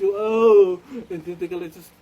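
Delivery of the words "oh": a loud wailing "oh"; loud cry. Retold or imitated. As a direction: let out a loud crying oh. Friend's reaction.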